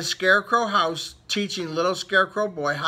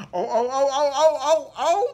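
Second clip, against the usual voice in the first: rising alarm; 8 semitones higher, 4 dB louder than usual. Acted. Crow flying at the boy.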